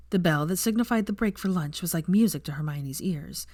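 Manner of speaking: ordinary delivery, nothing marked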